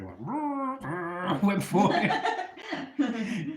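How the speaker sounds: high, slow words through laughter; laughing. Real, not acted. Laughs retelling the fight.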